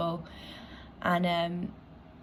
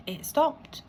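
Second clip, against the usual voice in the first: ordinary talk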